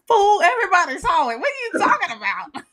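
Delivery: loud laughing mock scolding. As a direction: laugh while you scold him. Judges scold [name].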